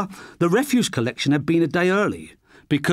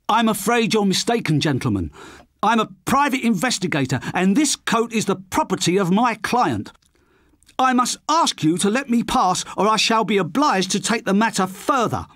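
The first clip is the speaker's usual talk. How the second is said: higher, prissy, trying to sound official; a lame attempt at sounding official; prim Carter voice; 8 semitones higher than usual. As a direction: bluff authority he doesn't feel. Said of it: "he finished lamely".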